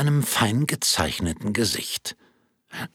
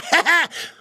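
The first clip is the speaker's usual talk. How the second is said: high laugh; 3 dB louder than usual. Acted. Audience laughter.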